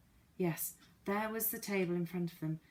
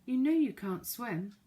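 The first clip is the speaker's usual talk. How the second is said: calling out; 6 semitones higher, 5 dB louder than usual. Acted. Calling to clock at sea.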